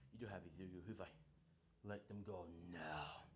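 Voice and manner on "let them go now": low menacing demand. Acted. Izuku threatening the villain.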